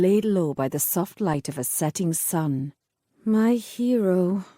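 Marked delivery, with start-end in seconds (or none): 3.2-4.4 slow, dry ironic mutter. Acted. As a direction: mutter it slowly, wryly. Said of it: "she muttered".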